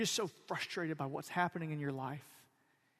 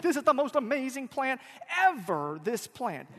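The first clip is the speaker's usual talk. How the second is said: gushing delight; 8 semitones higher, 8 dB louder than usual. Retold or imitated. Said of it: "like he was just like".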